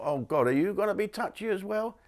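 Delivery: high, hushed exasperation; mock weary and high; exasperated thought. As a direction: groan inwardly. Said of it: "I thought".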